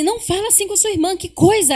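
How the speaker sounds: scolding son. Retold or imitated. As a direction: tell off the son sharply. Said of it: "falo assim".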